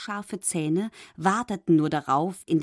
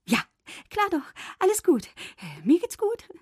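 high, far-off voice putting on a brave front; brave little fish; 11 semitones higher than usual. A narrator's reading. Little fish to mermaid, pretending he is fine, high and brave. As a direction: squeak out that everything is fine. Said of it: "Aber er sagte tapfer".

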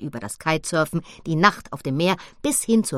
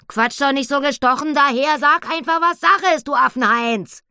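high, loud, scolding pig voice; impatient piglet; 9 semitones higher, 8 dB louder than usual. Acted. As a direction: tell him off impatiently. Annabella scolds the gorilla. (Annabella, ungeduldig, hoch und laut)

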